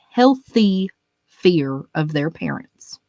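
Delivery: healthy said slow and weighted; stress on healthy. Shown as a demonstration. Comic hammering his parenting rule, slow stress on healthy. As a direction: hammer the word healthy, slowly. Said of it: "I'm putting emphasis on this word".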